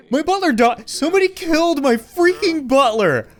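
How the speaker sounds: shocked outburst. Real, not acted. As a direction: shout it in stunned disbelief. Discovers murdered butler.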